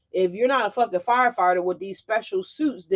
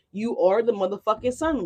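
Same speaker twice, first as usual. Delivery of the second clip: ordinary talk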